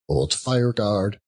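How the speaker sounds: plain reading voice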